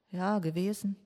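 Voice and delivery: normal talking voice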